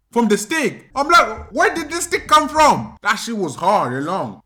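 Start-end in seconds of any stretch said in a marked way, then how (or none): 1.5-3.0 high, loud bewildered question; acted startled protest. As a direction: cry out as the startled child. Acted child protest while being beaten.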